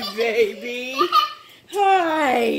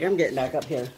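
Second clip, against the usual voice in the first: voicing the dog; 12 semitones lower, 5 dB quieter, much faster than usual. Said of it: "She's like".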